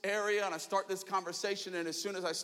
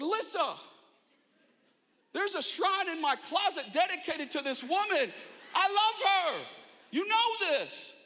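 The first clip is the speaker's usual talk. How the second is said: exasperated; 9 semitones higher than usual. Retold or imitated. Brother to his sister about his crush, exasperated. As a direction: insist it's obvious, almost shouting.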